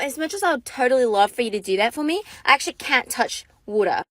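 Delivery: low, put-on Australian voice; mock Australian role-play; fake Australian accent. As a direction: play the coy Aussie, pitched low. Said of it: "I had to keep playing the role".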